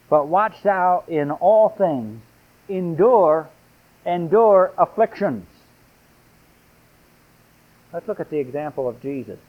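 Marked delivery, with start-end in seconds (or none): 0.1-5.4 slow, loud scripture read; forceful pulpit reading; fervent preaching. Acted. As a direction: hammer each word of the verse.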